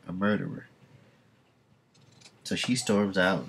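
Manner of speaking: usual speaking voice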